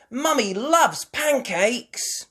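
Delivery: put-on high, loud, drawn-out child's cheer; excited cheer. Child telling the family about pancakes, cheering. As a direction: announce it with squealing delight. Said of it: "cheered Peppa".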